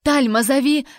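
loud, high cry for help; alarmed command; desperate shout. A narrator's reading. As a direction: yell urgently to someone outside. Heroine cries out for help.

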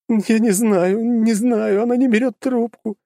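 high, tearful, distraught daughter; crying daughter. A narrator's reading. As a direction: daughter weeping helplessly. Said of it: "продолжала плакать Людмила".

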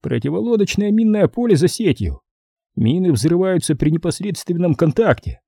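lightly voiced colonel listing defences; colonel briefing. Colonel explaining the strait's defences, lightly voiced briefing.

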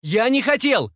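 high, drawn-out protest; high-pitched frantic pleading; desperate cry. A narrator's reading. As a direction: keep crying it out as they grab you. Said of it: "ещё кричал он".

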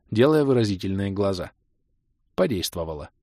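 ordinary delivery, nothing marked

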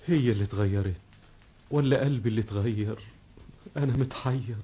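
low, soft, lost in thought; brooding monologue. Acted. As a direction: muse to himself in bewilderment. (مناجاة حائرة منخفضة هادئة)

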